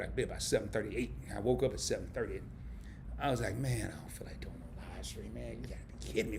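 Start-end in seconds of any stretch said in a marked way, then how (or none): 3.6-6.4 whispered grumble to himself; whispered reluctance before going live; grumbling whisper. Retold or imitated. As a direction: mutter it under your breath, not wanting to start. Inner grumble about starting stream.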